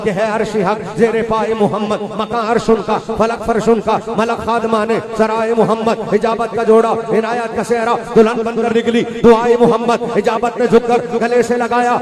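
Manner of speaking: rhythmic declamation climbing in pitch; fervent verse recitation. Real, not acted. Preacher quoting the poet's naat, mounting rhythmic recitation. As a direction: fire off short metrical phrases, rising gradually.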